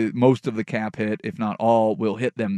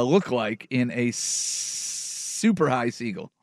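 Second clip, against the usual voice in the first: usual speaking voice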